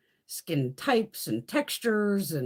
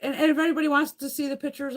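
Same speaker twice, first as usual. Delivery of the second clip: eager; 10 semitones higher, 5 dB louder than usual. Real, not acted. Eager offer to viewers.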